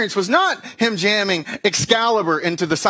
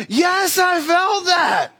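much higher, slowed, strained yes; exasperated pained reply; 12 semitones higher, much slower than usual. Retold or imitated. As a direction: squeeze it out through the pain, begging.

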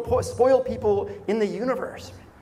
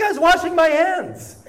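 much higher and louder protest, almost a squeak; indignant protest; 10 semitones higher, 6 dB louder than usual. Retold or imitated. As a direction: defend yourself in mock outrage. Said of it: "I'm like".

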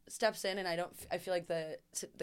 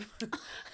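airless silent wheeze of a laugh; breathy laugh demo; 8 dB quieter than usual. Shown as a demonstration. Showing her friend how she laughed, silent wheezy laugh. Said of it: "And I laugh exactly like that".

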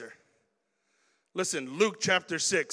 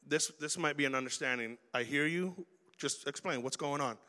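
soft, lower, de-escalating; calm, reassuring; 5 semitones lower, 8 dB quieter than usual. Retold or imitated. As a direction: lower the temperature gently. Calm reply to angry person.

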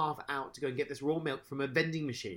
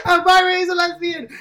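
shouted much higher and louder in delight; excited shout; 18 dB louder than usual. Real, not acted. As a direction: yell it out thrilled.

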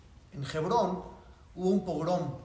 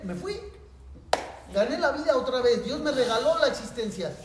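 relieved joy; 5 semitones higher, 3 dB louder than usual. Retold or imitated. Rabbi relieved, saved.

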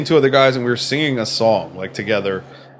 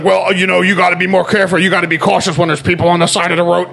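higher-pitched, insistent reprimand; lecturing officer; 5 semitones higher than usual. Retold or imitated. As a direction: imitate a cop giving a safety lecture. Retold officer lecturing driver.

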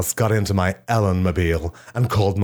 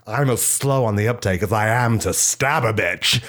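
ordinary talk, nothing marked

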